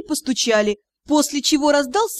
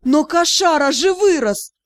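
high, loud, stretched protest; indignant; 6 semitones higher, 5 dB louder than usual. A narrator's reading. Ghost protesting to the captain, indignant. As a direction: object indignantly, dragging the words. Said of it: "возмутилась Дара".

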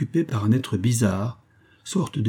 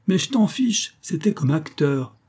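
put-on much higher, impish kid retort; snappy girl voice, higher; cheeky child; 6 semitones higher than usual. A narrator's reading. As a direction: brat scoffing.

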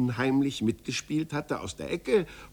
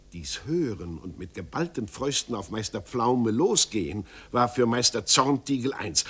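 usual speaking voice

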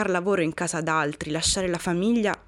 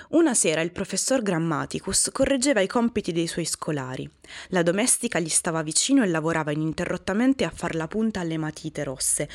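ordinary delivery, nothing marked

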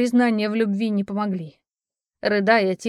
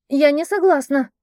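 high, loud outcry; shouted protest; 6 semitones higher, 7 dB louder than usual. Acted. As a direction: yell it out in defiance, higher and louder. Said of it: "выкрикнула Фриста".

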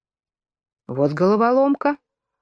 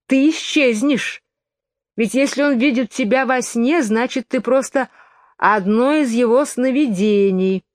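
twin's voice; 6 semitones higher than usual. A narrator's reading. Twin teasing Alice.

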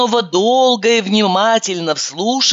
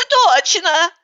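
Katerina agreeing; 10 semitones higher than usual. A narrator's reading. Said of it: "вторила ему Катерина".

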